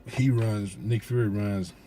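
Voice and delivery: ordinary talk, nothing marked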